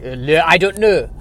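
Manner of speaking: silly, badly faked French accent; mock French accent. Comic guessing how the French say it, mock French accent. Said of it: "however the French say".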